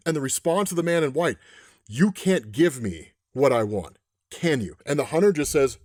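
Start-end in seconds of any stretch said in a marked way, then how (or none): 1.9-4.8 low, slow gunslinger threat; cold menace. Retold or imitated. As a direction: toy with a captive, slowly and low.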